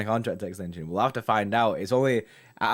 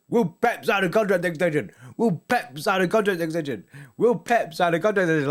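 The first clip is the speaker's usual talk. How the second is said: mock chat spam; 6 semitones higher than usual. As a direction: parrot the spammed question, hammed-up. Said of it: "Why are you shouting at me for?".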